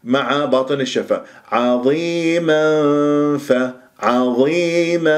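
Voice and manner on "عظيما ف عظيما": عظيما slow, nasal hiding into فاء; hidden nun. Shown as a demonstration. Teacher showing ikhfa in عظيما فكيف. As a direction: hide the نون into فكيف. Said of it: "بهذه الطريقة".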